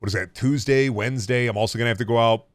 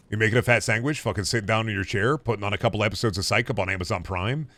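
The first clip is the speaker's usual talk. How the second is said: ordinary talk, nothing marked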